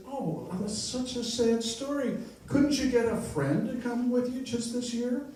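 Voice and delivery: young woman comforting a crying stranger, tender mock voice. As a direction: coo sympathy at the old man. Woman sympathises with old man.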